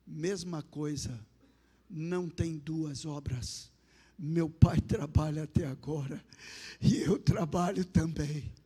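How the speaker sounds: slow, measured pronouncement; divine voice. Retold or imitated. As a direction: voice of God answering with deliberate pauses. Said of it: "E a voz falou assim pra mim". (voz divina, pausada e solene)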